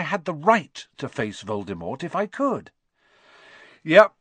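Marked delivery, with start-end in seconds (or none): 3.8-4.1 put-on loud, higher boyish pride; proud, emphatic burst. A narrator's reading. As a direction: crow it proudly, punching the first words. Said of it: "said Ron proudly".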